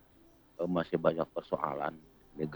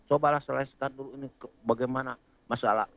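heated; 6 semitones higher, 6 dB louder than usual. Real, not acted. Caller rants about government priorities.

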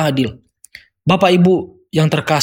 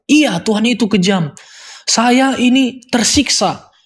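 sick caller; 6 semitones higher than usual. Retold or imitated. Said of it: "seperti sakit stroke dari kedengaran".

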